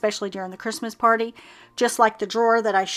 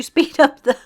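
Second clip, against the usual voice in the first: laughing; 5 semitones higher, 7 dB louder than usual. Real, not acted. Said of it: "just a little laugh at myself".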